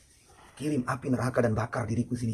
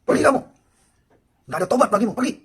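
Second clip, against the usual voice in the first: furious, much higher and louder dismissal; the Prophet shouting the sinner away in anger; angry shout; 11 semitones higher, 11 dB louder than usual. Retold or imitated. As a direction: drive him away with a shout. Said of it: "sebelum saya marah".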